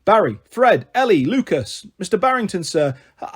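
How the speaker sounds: ordinary delivery, nothing marked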